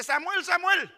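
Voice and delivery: loud, high-pitched name call. Acted. God calls the sleeping boy Samuel, loud calling out.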